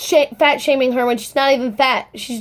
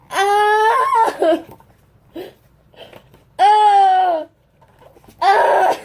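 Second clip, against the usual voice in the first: fangirl scream; 7 semitones higher, much slower than usual. Real, not acted. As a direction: squeal at the album pictures. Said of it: "I look like a crazy person".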